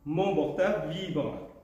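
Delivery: deliberate poem reading, each line set apart by a pause; haiku recitation. Acted. Poet reads prize-winning haikus to the audience, deliberate recitation. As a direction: lift the opening line, let the last one settle.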